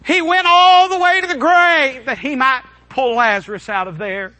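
high, loud pulpit proclamation; full-force preaching. Real, not acted. Preacher telling how Jesus came to the grave, full force. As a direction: ring it out to the whole church.

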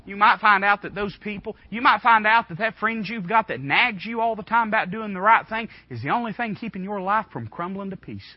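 normal talking voice

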